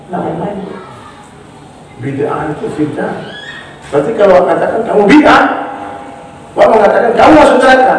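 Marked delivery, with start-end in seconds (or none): 3.9-7.9 stern warning. Real, not acted. Preacher warning strongly.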